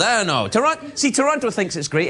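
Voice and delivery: normal talking voice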